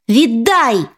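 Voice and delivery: usual speaking voice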